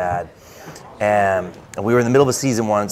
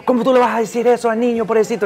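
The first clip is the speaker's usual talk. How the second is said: high, rapid-fire Spanish scolding; mom yelling; 14 semitones higher, 4 dB louder, much faster than usual. Retold or imitated. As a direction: imitate an outraged mom tearing into dad. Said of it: "she starts yelling at him in Spanish".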